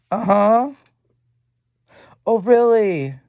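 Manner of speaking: high mocking oh really; drawn-out sarcastic uh-huh; sarcastic disbelief. Real, not acted. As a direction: scoff at the claim.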